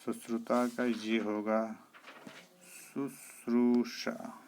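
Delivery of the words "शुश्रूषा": शुश्रूषा drawn out syllable by syllable; correct pronunciation. Shown as a demonstration. Teacher gives the right form, slow split शुश्रूषा. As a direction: stretch "shroo" in slow शुश्रूषा. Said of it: "ये शुद्ध होगा".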